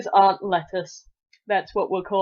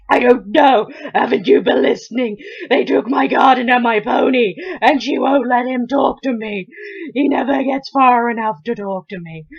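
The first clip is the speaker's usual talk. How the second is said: loud, pitched-up acted outburst; exasperated boy; 4 semitones higher, 9 dB louder than usual. A narrator's reading. Boy rounds on Polly for not listening, exasperated. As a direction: complain loudly, at the end of your patience. Boy snaps at girl's question.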